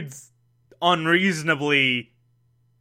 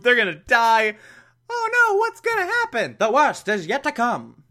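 high, hammed-up panic at the cliffhanger; cartoon-serial dread, high and overdone; mock cliffhanger drama; 7 semitones higher than usual. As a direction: play up the peril like a serial announcer. Melodramatic cliffhanger recap.

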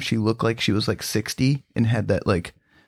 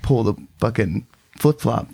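ordinary delivery

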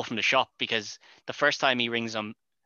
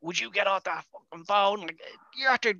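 angry; 9 semitones higher than usual. Acted. Shouting at Ed about phone.

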